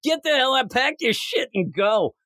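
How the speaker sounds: high-pitched mock-indignant send-off; dismissive order. [name] tells Paul to leave, mock-indignant. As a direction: order him out, theatrically.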